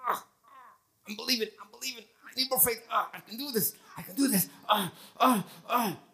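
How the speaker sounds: high, strained, pleading mock effort; mock straining believer. Preacher mocks people straining to believe, high and strained. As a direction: ham up someone forcing their faith. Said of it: "most people, including me in the past, like this".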